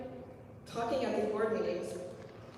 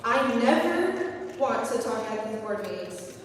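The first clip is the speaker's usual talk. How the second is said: usual speaking voice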